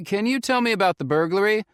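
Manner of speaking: put-on urgent young man on the phone; Felix calling. A narrator's reading. Felix asking the ranger.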